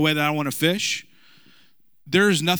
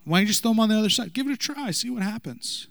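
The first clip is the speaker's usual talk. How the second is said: sarcastic suggestion; 7 semitones higher than usual. Retold or imitated. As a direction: tease them with a sly, airy lilt. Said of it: "if he's not sarcastic".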